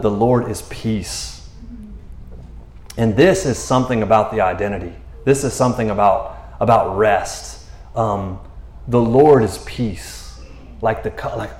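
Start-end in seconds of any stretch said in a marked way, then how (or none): 0.0-1.5 slow solemn. Acted. Naming the altar solemnly. 8.9-10.4 slow solemn. Acted. Repeats the name reverently.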